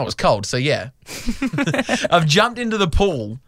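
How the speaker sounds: normal talking voice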